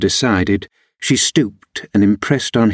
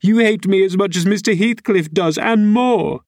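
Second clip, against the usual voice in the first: put-on much higher, tearful girl's voice; weeping; 11 semitones higher than usual. A narrator's reading. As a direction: accuse him through acted tears. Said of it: "wept Cathy, no longer disguising her trouble".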